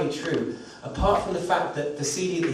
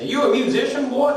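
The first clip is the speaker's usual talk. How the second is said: put-on high, loud Southern hobo accent; mock Alabama accent, called out high and loud; 10 semitones higher, 5 dB louder than usual. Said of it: "let's do the accent".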